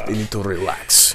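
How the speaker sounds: put-on soft Polish-accented voice; low-key Polish accent. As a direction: repeat it as a laid-back Polish man. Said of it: "he was really cool Polish".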